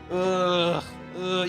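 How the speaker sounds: usual speaking voice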